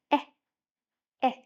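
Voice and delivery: Eh as short e with cheeks lifted in a smile; short E sound. Shown as a demonstration. Teacher contrasting the egg sound with apple, smiling short e. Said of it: "Hold the egg and smile softly".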